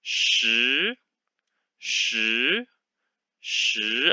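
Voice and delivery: 十 drawn out, rising like a question; rising second tone. Shown as a demonstration. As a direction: let 十 rise up at the end. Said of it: "rise up to the, like a question".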